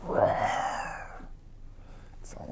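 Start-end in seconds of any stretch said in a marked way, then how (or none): 0.0-1.2 soft, breathy little roar; a hushed lion roar for the child; whispered roar. Shown as a demonstration. Asking the toddler about the lion, a whispered roar. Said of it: "you know this animal".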